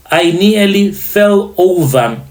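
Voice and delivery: usual speaking voice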